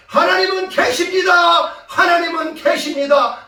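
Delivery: loud, high proclamation; triumphant shout. Retold or imitated. Pupils at the window proclaiming God, imitated shout. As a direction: imitate pupils crying it out at full voice. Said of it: "라고 외쳤다는 내용입니다".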